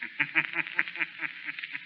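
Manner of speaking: soft, eerie mocking chuckle from the dark; sinister laugh. The Shadow laughing at the fooled Burkett, eerie chuckle.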